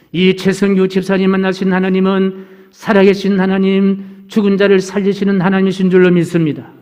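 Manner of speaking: chant-like list on a level pitch; declamatory preaching. Real, not acted. Preacher assuring congregation of God who heals, declamatory. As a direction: proclaim each phrase to the whole congregation.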